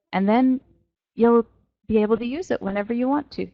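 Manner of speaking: ordinary talk, nothing marked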